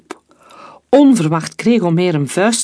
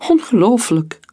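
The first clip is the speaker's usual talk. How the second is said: high, overwhelmed disbelief; a touch of tearful amazement, pitched up; shaken through tears; 6 semitones higher than usual. A narrator's reading. Rescued woman embracing her saviour, shaken through tears. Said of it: "klonk het schokkend door haar tranen heen".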